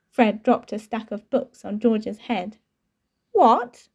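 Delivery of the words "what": a sharp, high and loud what; a brother caught off guard; shocked. A narrator's reading. Shocked brother hears news.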